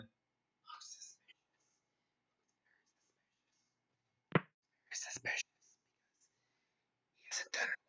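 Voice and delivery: hushed whisper, no voice at all. Real, not acted. Poker streamer eyeing a suspicious limp, whispering. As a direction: breathe it out like a secret at the table. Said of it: "picking up my whispering".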